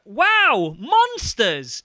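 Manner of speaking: put-on high, loud kid companion shout; excitable game sidekick. Imitating the annoying kid companion, high loud exclamation. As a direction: exclaim it like an over-eager game helper. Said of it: "he's just like".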